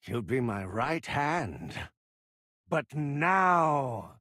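ordinary talk, nothing marked

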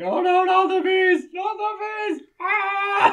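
high, loud mock-panicked scream. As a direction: shriek it like a hammed-up bee attack. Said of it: "he starts screaming".

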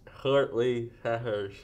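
very slow, mushy repeat; slow mouth guard speech. Real, not acted. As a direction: push each word out round a guard. Repeating slowly through mouth guard.

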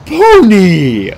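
loud, drawn-out gleeful cry; rapturous cry. A narrator's reading. Tyson spots the centaur, cries out in rapture. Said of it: "he cried in total rapture".

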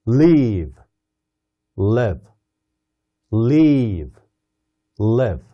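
"live" alternating a long "ee" and a short "i"; long vs short vowel. Shown as a demonstration. Teacher drilling "live" with long and short vowels in turn. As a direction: swap "live" between "leeve" and a clipped "liv". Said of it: "발음이 다르다는 걸".

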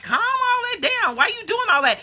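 high-pitched mock complaint; imitated exasperation. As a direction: act out scolding someone who is too rowdy. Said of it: "I'm like".

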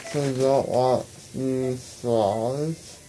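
slurred numb mouth with gauze; after wisdom teeth. Real, not acted. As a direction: talk around gauze, numb and slow.

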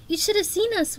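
high, bubbling excitement; excited. A narrator's reading. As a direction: gush it out breathlessly. Sunny excited about escape.

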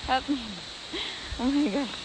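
ordinary delivery

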